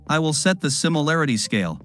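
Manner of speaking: ordinary delivery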